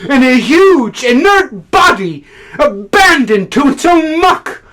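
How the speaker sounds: high, heavily punched tirade; impassioned disgust. Acted. Critic condemning Russia as a gigantic child, impassioned. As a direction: hurl each stressed word out.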